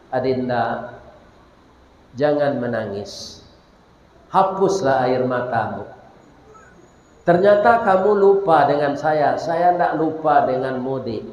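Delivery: soft, low voice reading a kind note; tender letter. Acted. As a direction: read it gently, as comfort. Doctor's note to the girl.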